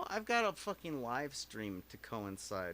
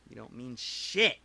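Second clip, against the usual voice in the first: loud taunt; 9 dB louder than usual. Real, not acted.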